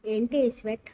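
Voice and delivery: ordinary delivery, nothing marked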